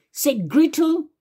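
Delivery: ordinary delivery, nothing marked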